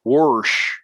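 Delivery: "Worsh" for wash, r slipped in; Missouri worsh. Shown as a demonstration. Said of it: "you know, like, I heard that".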